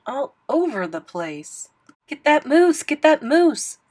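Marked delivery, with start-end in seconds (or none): 2.1-3.8 loud, high hunting cry; hunter's call. A narrator's reading. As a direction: bellow it across the hills. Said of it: "Thidwick heard a voice call".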